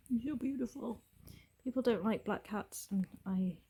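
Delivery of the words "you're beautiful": high, adoring pet voice; cooing at pet. Real, not acted. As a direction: tell the cat sweetly, pitched up. Admiring her cat, to the cat.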